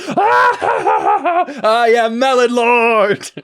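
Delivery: high, loud manic cackle; cackling madly. Retold or imitated. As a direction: cackle it out like a mad villain. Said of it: "We just hear Deli cackling madly".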